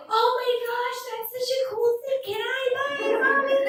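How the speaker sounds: put-on high, soft child's voice begging Mommy; high-pitched childlike pleading; excited little kid. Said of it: "'Cause we're like".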